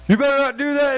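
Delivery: high, loud scolding parent; stern parent warning. Retold or imitated. Parent warning a disobedient child, high loud scold. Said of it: "and a parent goes".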